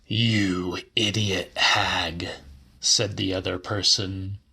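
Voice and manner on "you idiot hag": slow, hissed whisper of contempt; scornful whisper. A narrator's reading. Man whispering an insult at the old woman. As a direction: breathe the insult out slowly, no voice.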